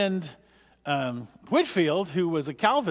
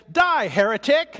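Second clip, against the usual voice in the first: slow, louder, higher hammed-up fury; mock fanatic yell; 9 semitones higher, 7 dB louder, slower than usual. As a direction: bellow it like a comic zealot. Joke punchline, pushing man off bridge.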